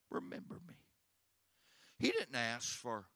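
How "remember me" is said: soft, breathless whisper of the plea. Acted. The dying thief begs Jesus in a whisper. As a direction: whisper it as a last hope.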